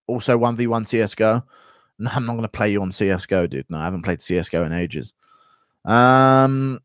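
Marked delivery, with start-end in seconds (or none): none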